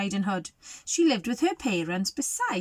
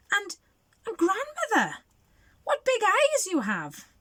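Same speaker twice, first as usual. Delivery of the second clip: put-on little girl voice; 10 semitones higher than usual. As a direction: wonder aloud like a small child. Girl voiced, high and slow.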